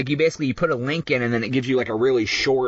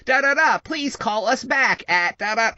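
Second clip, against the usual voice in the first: higher, slow robocall voice; automated voice; 7 semitones higher, slower than usual. Retold or imitated. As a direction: read it like a recorded message. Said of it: "it's an automated voice recording".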